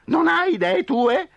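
high whiny owl; complaining owl. Acted. Owl complains to Coyote, high whine. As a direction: grumble at Coyote's repetition. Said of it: "si lamentò Gufo".

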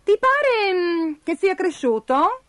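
lightly voiced character musing at the mirror; self-admiring character. A narrator's reading.

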